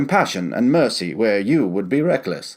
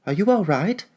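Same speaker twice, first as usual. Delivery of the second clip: put-on worried young voice; 9 semitones higher than usual. Young traveler checks on grandfather.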